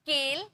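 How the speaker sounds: केल with a long "ay"; wrong AY vowel. Shown as a demonstration. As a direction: say केल as "kayl". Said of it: "ऐसे हम बोले क्या?". (केल में लंबा ए)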